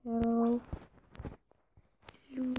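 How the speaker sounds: ordinary talk, nothing marked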